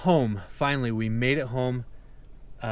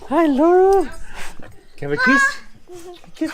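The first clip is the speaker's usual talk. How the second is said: joyful reunion; 5 dB louder than usual. Real, not acted. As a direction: coo at your daughter. Greeting daughter after the trip.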